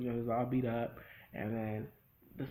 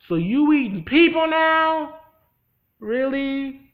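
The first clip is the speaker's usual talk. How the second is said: much higher, louder, slow disbelief; incredulous shout; 13 semitones higher, 15 dB louder, slower than usual. Pretend. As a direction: yell it in outraged disbelief. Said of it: "And I'm like".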